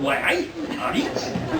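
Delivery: slow, breathy-laughing Geordie "why aye"; Geordie accent. Retold or imitated. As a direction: imitate Nicola's Geordie drawl with a chuckle. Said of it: "in her beautiful Geordie accent".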